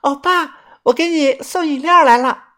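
high bright call across the distance; sweet high-pitched shout; calling from afar. A narrator's reading. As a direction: wave and call out to him. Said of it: "挥手喊道".

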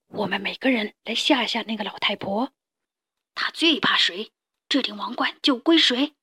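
hushed, conspiratorial whisper; whispering lion. A narrator's reading. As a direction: let the rivals in on a secret plan. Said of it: "狮子小声说".

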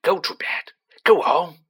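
forced, rasped loud whisper of an order; snarl. A narrator's reading. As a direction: snarl the order, mostly on breath. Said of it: "he snarled at Harry".

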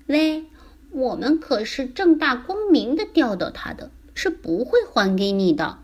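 cheeky little fish. Acted. Little fish refusing to return the captured dad, cheeky. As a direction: refuse as a self-righteous small creature.

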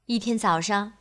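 plain reading voice

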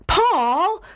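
high-pitched reproachful cry of a name; exclaiming. A narrator's reading. Jenny scolds Paul for his nosy question, exclaiming. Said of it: "I exclaimed".

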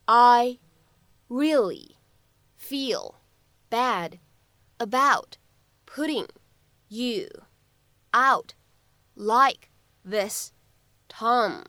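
each word said slowly and separately with pauses. Shown as a demonstration. Teacher splitting the line into separate words for students. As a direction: break the line into single words. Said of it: "word by word teaching read".

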